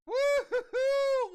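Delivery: real delighted cheer; laughing whoop. Real, not acted. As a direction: whoop and laugh at a huge pull. Hyped card pull reaction.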